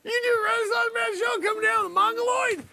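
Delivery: high, loud helpless laughing protest; breaking character. Real, not acted. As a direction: squeal it through real laughter. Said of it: "started to break character".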